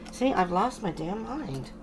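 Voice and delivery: usual speaking voice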